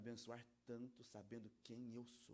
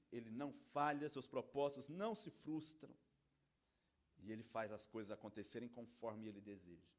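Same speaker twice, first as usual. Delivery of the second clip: usual speaking voice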